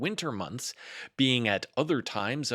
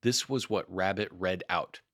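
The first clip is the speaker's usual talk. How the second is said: ordinary delivery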